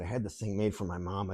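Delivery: low, very soft acted appeal; hushed plea. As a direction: drop to a quiet, confiding plea. Acted sob story for sympathy.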